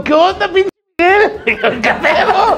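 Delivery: reclamo agudo entre risas; asombro actuado. Retold or imitated. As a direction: imitar a los amigos que encuentran al perdido. Friends acted finding lost Miguel. (sorpresa exagerada y muy aguda)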